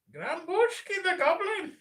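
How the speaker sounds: very high, slow, loud goblin voice; hammed-up high goblin announcement. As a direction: drag out the name like a squeaky goblin.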